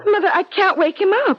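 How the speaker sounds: fast and high, near panic; a daughter phoning her mother at night, panicky; panicked. Acted. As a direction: blurt it out in a rush.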